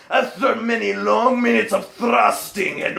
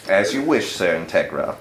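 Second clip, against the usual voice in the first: butler Walter; 13 semitones lower than usual. Acted.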